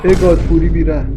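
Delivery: sudden loud yell; shout. Acted. Player shouting at the game character, loud. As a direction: bark it out at full volume.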